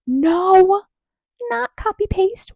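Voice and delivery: high, drawn-out exclamation; squealed, theatrical denial; emphatic denial. Acted. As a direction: cry it out high in mock astonishment. Answers own mock-outraged question.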